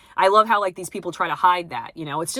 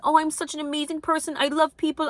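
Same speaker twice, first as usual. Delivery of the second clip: high, airy, fake-sweet voice; sarcastic impression of a self-congratulating nice person; mock self-praise; 9 semitones higher than usual. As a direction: parody a smug do-gooder. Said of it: "I'm not saying".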